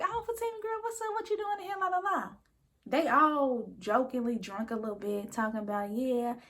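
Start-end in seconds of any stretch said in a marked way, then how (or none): 0.0-2.3 put-on high, sing-song tipsy girlfriends; imitating friends, much higher and sing-song; mock bubbly greeting. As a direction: gush at her like tipsy friends.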